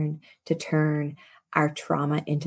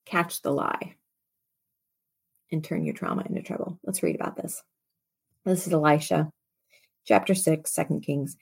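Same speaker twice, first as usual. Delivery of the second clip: usual speaking voice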